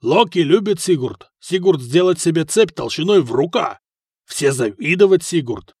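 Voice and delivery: loud-ish boast in broken Russian; emphatic, swinging warrior's brag; boastful Dane. Acted. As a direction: crow about the coming win.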